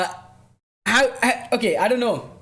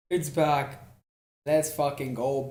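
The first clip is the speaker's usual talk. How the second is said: ordinary talk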